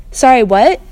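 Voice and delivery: loud, high call; shouted question. Acted. Bella calling over the rain, loud.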